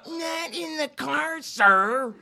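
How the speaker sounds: high, mocking seven-year-old girl voice; childish mock repeat; mocking little girl. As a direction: parrot it back like a bratty little girl. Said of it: "He's mocking me like a seven-year-old girl".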